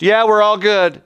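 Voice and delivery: mock cheerful report. Village men assuring the counters, high breezy cheer. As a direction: play it bright and casual.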